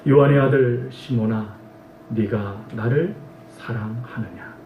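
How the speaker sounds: Jesus asking Peter gently, softly and slowly; gentle question. Acted. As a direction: ask tenderly, drawing it out. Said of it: "이렇게 말씀하시죠".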